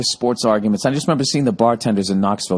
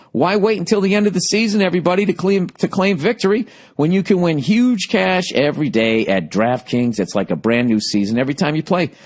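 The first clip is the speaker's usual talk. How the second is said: upbeat sponsor read, pitched up; 4 semitones higher than usual. Acted. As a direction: sell it like a radio spot. Reads DraftKings ad.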